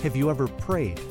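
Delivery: ordinary talk, nothing marked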